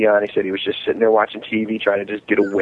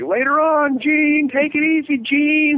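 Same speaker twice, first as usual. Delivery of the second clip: much higher, teasing sing-song; mocking farewell; slower than usual. Friends tease the drunk guy in makeup, mocking. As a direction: taunt him sweetly as he leaves. Friends mock the painted face.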